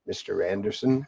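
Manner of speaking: a hint of the Matrix villain; Agent Smith voice. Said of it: "Can you read it like Agent Smith?".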